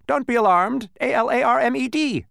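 high, loud bee spelling out. A narrator's reading. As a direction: spell it proudly, letter by letter.

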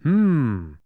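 long, loud, falling "hmm"; pondering hum. A narrator's reading. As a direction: hum at length, puzzling it over. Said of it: "scratching his head".

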